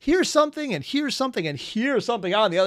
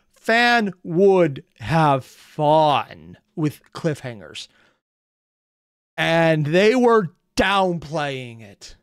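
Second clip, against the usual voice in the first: very slow, heavily punched words; emphatic exasperation; 4 dB louder, much slower than usual. Real, not acted. Reactor complaining about downplayed warnings, slow emphatic exasperation. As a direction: drag each word out for emphasis.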